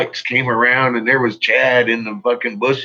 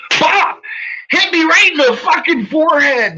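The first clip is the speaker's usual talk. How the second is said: loud, high burst like the blow landing; shouted re-enactment; 6 dB louder than usual. Retold or imitated. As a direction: punch it out loud, reliving the hit. Re-enacts the punch to his forehead.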